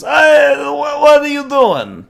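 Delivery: put-on shouted, high-pitched botched Brooklyn accent; attempted Brooklyn accent. Fellow bear protests the headbutt, high shouted bad Brooklyn accent. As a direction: yell it slowly in a haywire Brooklyn accent. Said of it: "he's attempting a Brooklyn accent".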